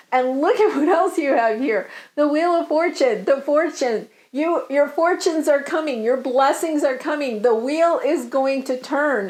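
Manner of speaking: usual speaking voice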